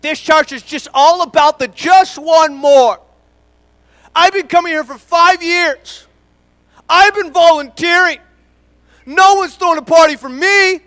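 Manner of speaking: mock the member who feels overlooked; griping churchgoer. Pastor imitating a long-time member complaining nobody celebrates them. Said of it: "let me tell you what it sounds like in our church".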